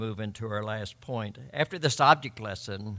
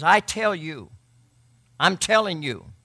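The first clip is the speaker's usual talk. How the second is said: "I tell you. I'm telling you." louder, pressing each word; emphatic; 6 semitones higher, 9 dB louder than usual. Shown as a demonstration. Pastor paraphrases Jesus emphatically for the congregation, loud and firm. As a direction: say it with full authority. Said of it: "that phrase there is emphatic".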